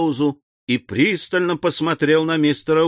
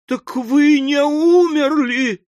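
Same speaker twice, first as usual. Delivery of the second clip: very high, drawn-out wail; slow, piercing hysterical cry; hysterical exclamation; 5 dB louder, slower than usual. A narrator's reading. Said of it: "восклицала истерическая леди".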